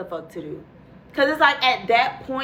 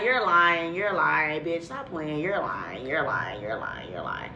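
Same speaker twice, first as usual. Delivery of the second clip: teasing chant fading lower and softer; mock teasing chorus; 7 semitones lower, 6 dB quieter than usual. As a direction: let the taunt die away. Friends mocking chorus, fading.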